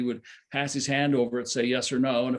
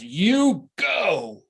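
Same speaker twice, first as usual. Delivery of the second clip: Baba's command; 7 semitones higher, 7 dB louder than usual. Retold or imitated. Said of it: "he went up to Lorraine and he says".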